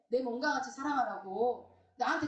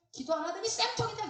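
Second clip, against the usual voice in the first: raised-pitch scornful jeer; mock gloating; 8 semitones higher than usual. Churchgoer about a prayerless neighbour in trouble, mock gloating. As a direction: crow over someone's misfortune, pitched up.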